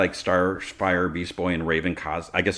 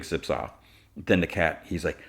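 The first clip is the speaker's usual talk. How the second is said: ordinary talk, nothing marked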